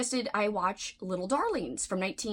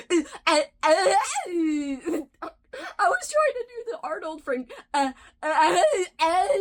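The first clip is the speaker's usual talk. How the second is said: high, loud strained screaming attempt; failed Arnold scream, pitched way up; 8 semitones higher, 6 dB louder than usual. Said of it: "I'm trying to do, like, an Arnold voice, like, screaming voice".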